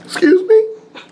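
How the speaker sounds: very high, loud incredulous question; stunned. Retold or imitated. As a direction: recoil in disbelief. Father asks him to preach.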